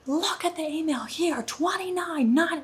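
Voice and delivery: retold angry shouting at the guard; exasperated yell. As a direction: jab at the date in rising fury.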